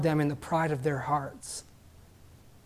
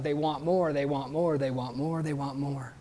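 fading repetition; 4 semitones lower, 8 dB quieter than usual. Acted. Repeated phrase fading away.

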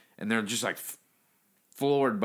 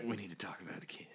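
quick unvoiced whisper; 3 semitones lower, 16 dB quieter, faster than usual. Shown as a demonstration. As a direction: whisper it fast, almost silent. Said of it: "that's a great reference".

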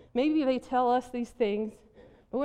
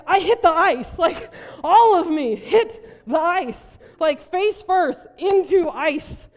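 louder, slow, hammed-up telling of the fall; dramatic emphasis; 3 semitones higher, 7 dB louder, slower than usual. Real, not acted. Pastor tells church about hitting the ice, loud and dramatic. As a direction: land the crash word by word.

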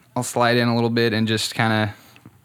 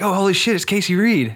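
mock-amazed, higher voice; retold surprise; 8 semitones higher than usual. Friend spots a guest joining the Periscope, retold surprise. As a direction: imitate a friend's thrilled recognition. Retelling Joe's surprised recognition.

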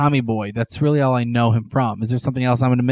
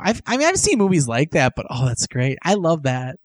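ordinary talk

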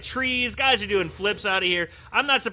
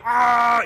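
pained yell; 4 semitones higher, 6 dB louder than usual. Retold or imitated. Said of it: "and he's going".

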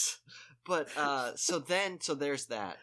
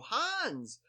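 high, falling call of HANS; called-out greeting; 12 semitones higher, 3 dB louder than usual. Retold or imitated. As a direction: imitate hailing someone by name, pitched up. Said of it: "And they just go".

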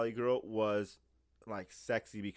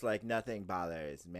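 lower, offhand imitation of aloofness; aloof; 5 semitones lower, 4 dB quieter than usual. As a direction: put on a lightly mocking, unbothered Valley tone. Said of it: "they're aloof".

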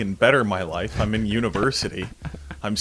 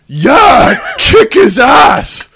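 much higher, louder, slow whooping; cheering shout; 17 dB louder, much slower than usual. Retold or imitated. People in a car cheering the fight, whooping shout. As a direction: egg him on from the car.

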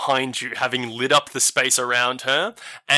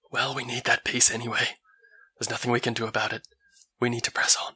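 quiet Elva voice; 2 semitones lower, 6 dB quieter, faster than usual. Acted.

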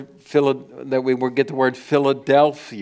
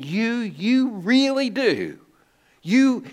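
"You, you really do. You" pitched much higher and drawn out slowly; slow, soaring emphasis on "you"; emphatic you; 9 semitones higher, slower than usual. Retold or imitated. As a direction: lift every "you" high and linger on it. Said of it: "he's emphasizing this".

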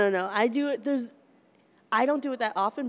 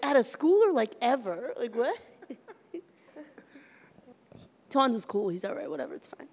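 ordinary talk, nothing marked

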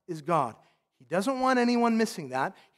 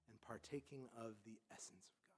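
whisper; 8 semitones lower, 28 dB quieter than usual. Acted. Reading scripture summary softly.

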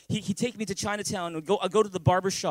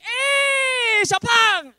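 high, booming, long-drawn holler; barber's greeting shout; 9 dB louder, much slower than usual. Retold or imitated. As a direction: belt out a huge, stretched hello. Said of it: "and the barber's there".

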